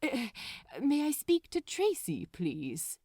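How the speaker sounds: overly proper, a bit rattled; flustered Aziraphale. Acted. Trying to lower his voice.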